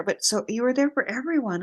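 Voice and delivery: normal talking voice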